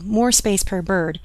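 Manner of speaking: ordinary delivery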